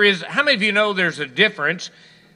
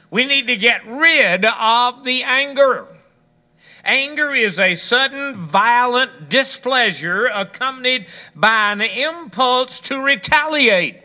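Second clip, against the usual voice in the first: fiery preaching; 6 semitones higher than usual. Acted. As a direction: build the sermon to its peak. Preaching builds to climax.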